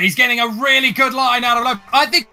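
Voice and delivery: rising, building play-by-play; excited race call. Real, not acted. Commentator calls Capet's overtake live.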